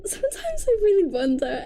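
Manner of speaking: high, wailing mock cry, half laughing; hammed-up anguish over bad books; mock despair. A book reviewer wailing to viewers in mock despair.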